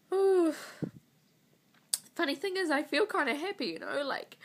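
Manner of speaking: high, loud, breaking through tears; a sob turning into a smile; tearful. Real, not acted. Woman telling viewers about her IVF, high and teary. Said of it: "crying my eyes out".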